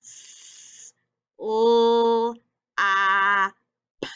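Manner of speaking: S, L, A, P as separate held sounds; teacher segmenting S, L, A, P sound by sound. Shown as a demonstration. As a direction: stretch each sound of S-L-A-P with gaps between. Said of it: "Let's tap it out".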